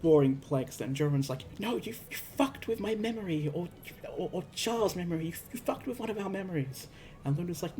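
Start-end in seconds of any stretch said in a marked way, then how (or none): 1.6-6.9 higher-pitched, stammering retold outrage; indignant mock confrontation; acted accusation. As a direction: imitate someone accusing a friend, words tumbling quickly.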